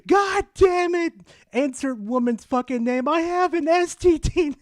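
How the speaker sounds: mock rage at catching something; furious outburst. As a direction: friend cursing after getting infected. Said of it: "Ten minutes fucking later".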